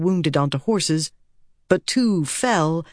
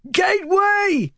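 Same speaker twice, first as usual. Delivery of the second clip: very high, drawn-out scream; screamed command. A narrator's reading. Woman fighting her horses, screaming an order. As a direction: scream the order over chaos. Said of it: "Fail screamed".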